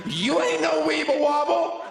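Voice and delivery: high, loud sing-song taunt; mock the person like a playground bully; taunting yell. Comic taunting a fallen girlfriend, high loud jeer. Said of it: "push you over and be like".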